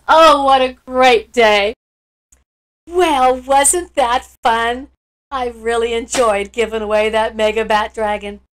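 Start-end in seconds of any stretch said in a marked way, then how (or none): none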